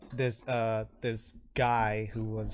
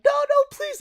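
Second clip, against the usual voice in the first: very high, loud frightened yelp; panicked pleading; 13 dB louder than usual. Acted. Man begging an angry orc for mercy, high and panicked. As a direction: beg frantically in a high voice.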